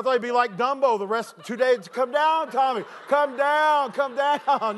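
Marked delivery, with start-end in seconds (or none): 1.9-4.8 high, drawn-out holler to someone aloft; calling him down. Retold or imitated. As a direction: call up high into the sky. Calling to Tommy in the air.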